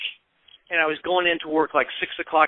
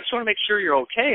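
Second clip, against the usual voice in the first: quick, higher, mildly nervous quote; retold nervousness; 6 semitones higher, faster than usual. Brother checking on him by phone, mildly nervous retelling. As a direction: imitate a nervous caller, hurrying slightly. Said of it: "he sounded all nervous".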